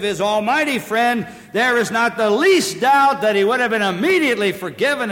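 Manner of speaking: high, loud pulpit voice; full-force preaching. Real, not acted. Preacher urging the congregation toward repentance, full force. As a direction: preach it out, driving hard.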